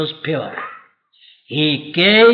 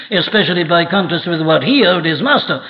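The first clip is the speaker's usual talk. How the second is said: ordinary delivery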